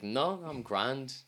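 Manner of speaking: mock-casual Irish shrug of a reply; drawn-out reassurance. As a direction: stretch it out, breezy and unbothered. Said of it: "It's like".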